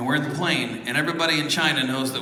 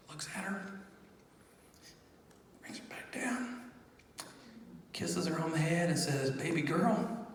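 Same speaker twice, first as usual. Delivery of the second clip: hushed retelling; 13 dB quieter than usual. Real, not acted. Telling the congregation of the handover, hushed and softer. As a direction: let the voice drop to a hush at the moment. Emotional moment handing baby over.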